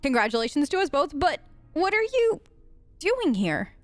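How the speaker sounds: ordinary talk, nothing marked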